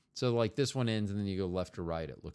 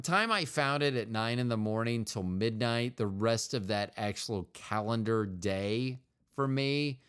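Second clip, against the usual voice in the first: ordinary delivery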